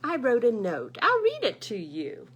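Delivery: loud eager goose voice. Acted. Goose telling bear about his note, loud eager voice. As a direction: bubble with pride over the note.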